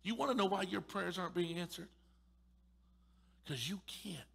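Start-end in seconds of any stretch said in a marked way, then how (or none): none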